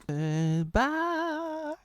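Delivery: high squeaky farewell; tiny high character voice; squeaky goodbye. Pretend. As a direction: pitch it way up for a silly character.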